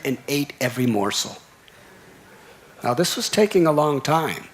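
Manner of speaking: usual speaking voice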